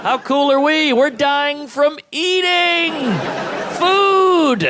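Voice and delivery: high boast building to a loud drawn-out yell; mock triumphant boast. Comic bragging that Americans die from eating, triumphant yell. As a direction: stretch the last words into a soaring holler.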